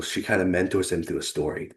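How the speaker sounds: ordinary talk, nothing marked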